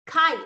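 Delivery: Kite with a strong K, high and loud; crisp teacher demo of Kite; demo word Kite. Shown as a demonstration. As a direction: model the K in Kite. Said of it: "as when creating the sound K".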